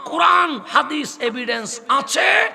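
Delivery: high, loud, drawn-out sermon cry; preaching at full force. Real, not acted. Preacher rousing the congregation at a mahfil, full-force sermon cry. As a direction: preach it out to the whole crowd.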